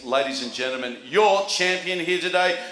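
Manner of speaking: building to a shouted name; announcer shout. Real, not acted. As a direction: call out the champion loudly. Announcing the champion again.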